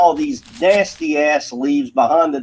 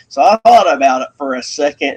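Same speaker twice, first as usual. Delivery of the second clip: usual speaking voice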